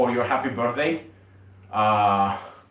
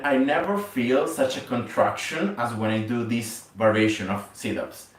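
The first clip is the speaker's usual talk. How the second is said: ordinary delivery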